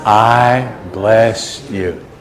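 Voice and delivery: very slow, words held out evenly; leading in unison. Real, not acted. Preacher leads the congregation's blessing together, slow and drawn out. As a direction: stretch it out for everyone to join.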